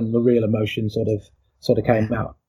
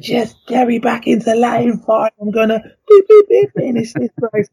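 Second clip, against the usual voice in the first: emotional outburst; 11 semitones higher, 4 dB louder than usual. Retold or imitated. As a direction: plead to be put back in the race, voice strained high. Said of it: "obviously I'm quite emotional".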